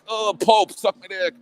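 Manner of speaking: high, cheeky jab at the Pope; mock cheeky taunt. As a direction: toss it off like a brash kid at the Vatican. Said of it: "run in there and be like".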